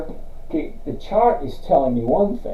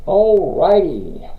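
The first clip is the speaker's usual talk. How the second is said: drawn-out alrighty; 4 semitones higher, 6 dB louder, much slower than usual. Real, not acted. Host kicks off stock review.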